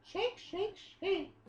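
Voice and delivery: put-on high, chirpy anime girl; sing-song it like a cute girl. Voices Aeon shaking her head.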